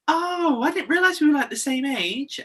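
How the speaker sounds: put-on young staff member surprised at the age; mock surprised girl. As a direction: play the young staffer taken aback.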